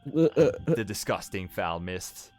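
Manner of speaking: ordinary delivery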